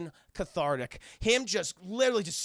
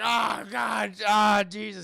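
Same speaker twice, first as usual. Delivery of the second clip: pained groan; 2 semitones higher, 5 dB louder, slower than usual. Retold or imitated. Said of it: "he's like".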